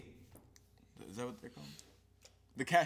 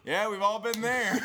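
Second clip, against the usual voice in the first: laughing through a glib reply; loud laughing quip, higher; sarcastic reply through laughter; 8 semitones higher, 5 dB louder than usual. Retold or imitated. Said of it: "and my response was".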